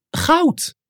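very high, excited squeak. A narrator's reading. Snif spotting what looks like gold, excited squeak. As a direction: squeak it out, pitch leaping far up. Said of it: "piepte Snif".

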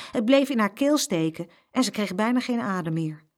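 ordinary delivery

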